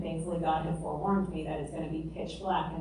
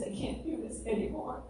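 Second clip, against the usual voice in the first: retold sobbing; 4 semitones higher, 7 dB quieter than usual. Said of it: "sobbing, saying".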